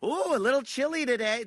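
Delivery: high, prissy mock chitchat about the weather; mocking small talk. Mocking commuters' small talk in a high chirpy voice. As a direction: mimic the morning crowd's chirpy small talk. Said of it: "And they're like".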